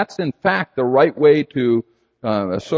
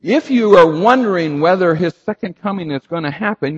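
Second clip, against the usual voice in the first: normal talking voice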